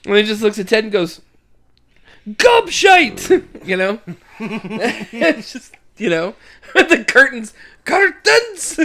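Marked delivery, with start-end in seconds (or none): 2.3-3.4 high loud barked insult; shouted insult. Retold or imitated. Father Jack looks at Ted, barking an insult. 7.8-8.8 shouted word. Retold or imitated. Quoting Jack again.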